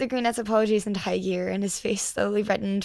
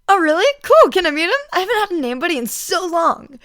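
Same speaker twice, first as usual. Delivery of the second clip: excited; 9 semitones higher, 10 dB louder than usual. A narrator's reading. Mike's excited outburst.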